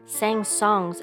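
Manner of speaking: plain reading voice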